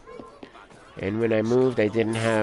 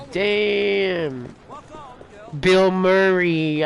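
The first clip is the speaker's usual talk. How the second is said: usual speaking voice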